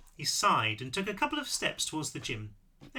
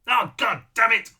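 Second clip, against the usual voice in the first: loud, drawn-out, high cry of frustration; frustrated yell; exasperated outburst; 7 semitones higher, 10 dB louder, slower than usual. A narrator's reading. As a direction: shout the curse slowly, at the end of your tether. Character explodes in frustration at herself.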